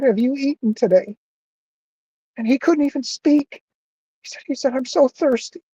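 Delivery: high, choked-up voice; getting emotional. Real, not acted. Telling of a thirsty collapsed man, choked up. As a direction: struggle to speak through real emotion. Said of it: "I'm getting emotional".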